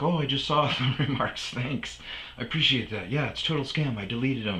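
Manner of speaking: sweetly grateful, a bit too bright; mock landlady thanks. Landlady quoted thanking him.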